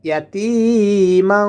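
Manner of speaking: slow "يتيما", long middle i and humming end; one-alif stretch. Shown as a demonstration. Teacher showing the stretch, slowly chanted "يتيما" with long i. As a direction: draw out the second syllable of "يتيما". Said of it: "এক আলিফ টেনে পড়তে হবে".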